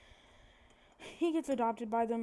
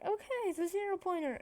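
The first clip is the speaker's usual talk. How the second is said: embarrassed; 9 semitones higher than usual. Retold or imitated. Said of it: "Mike's getting embarrassed".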